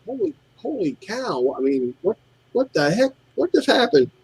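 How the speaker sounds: high, softened disbelief; retold astonishment. Retelling his stunned reaction to customer.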